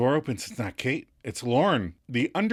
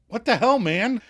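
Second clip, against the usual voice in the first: exasperated; 10 semitones higher, 4 dB louder than usual. Retold or imitated. Lauren annoyed.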